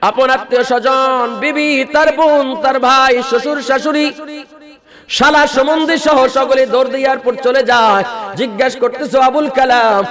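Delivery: high, chanted sermon cadence; full-force preaching. Acted. Preacher chanting the tale to the crowd, high and fervent. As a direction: preach it in a rising, sung tone.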